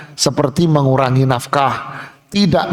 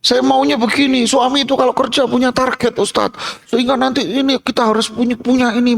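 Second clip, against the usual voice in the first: higher, quick insistent voice; demanding wife; 8 semitones higher, faster than usual. Acted. Wife telling the ustadz what she wants, high and fast. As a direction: lay out demands briskly.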